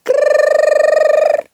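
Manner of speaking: high rattling alarm-clock ring; loud high mock alarm bell; alarm ring imitation. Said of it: "klingelte es wieder".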